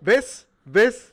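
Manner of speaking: loud, high, eager; excited. Real, not acted. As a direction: gleefully point it out. Excited about time check. (emocionado y fuerte)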